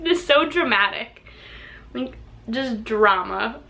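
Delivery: ordinary delivery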